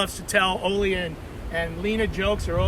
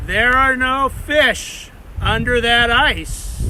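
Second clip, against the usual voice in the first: the same warning, slightly firmer and slower; a little stronger; 3 semitones higher, 4 dB louder, slower than usual. A narrator's reading. Unseen voice warns the fishers again, a little stronger. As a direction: push the unseen voice a touch stronger. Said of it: "a little stronger".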